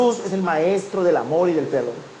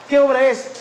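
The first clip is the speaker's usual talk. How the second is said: higher, louder eager question; 7 semitones higher, 5 dB louder than usual. Retold or imitated. New volunteer asking the recruiter, eager question. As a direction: ask it like a keen volunteer.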